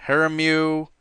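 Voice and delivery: "Haramu" ending in a "myoo" sound; mispronunciation. Retold or imitated. Quoting how people get her name wrong, "Haramu" ending "myoo". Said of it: "people have pronounced it".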